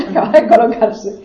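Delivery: a real laugh breaking into the line. Real, not acted. Laughs at body adjusting.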